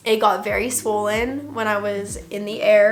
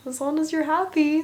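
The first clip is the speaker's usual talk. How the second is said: higher-pitched, lightly voiced worried dad; concerned dad; 6 semitones higher than usual. Dad reassuring his daughter after surgery, mildly concerned. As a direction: imitate a concerned father, pitched up. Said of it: "so concerned".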